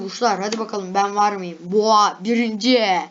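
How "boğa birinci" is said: loud, drawn-out triumphant call; excited cheer. Real, not acted. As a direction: celebrate coming first, loud and slow. Finds own sign listed first. (sevinçle, yüksek sesle)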